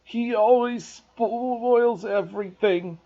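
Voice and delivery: slow, loud whiny blubbering; fake sobbing yell. A narrator's reading. Spoiled boy fakes sobs to his mother, whining. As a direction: put on crocodile tears, wailing. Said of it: "between huge pretend sobs".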